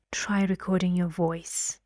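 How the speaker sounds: serious order with "voice" falling, not lifted; command, voice going down. Shown as a demonstration. Teacher repeats the order for students, serious falling intonation. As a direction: say it as a command, dropping on "voice". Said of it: "My voice going down".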